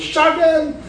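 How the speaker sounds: high, eager shout of the call; calling shotgun. Retold or imitated. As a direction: yell it to claim the front seat first. Friend calling the front seat.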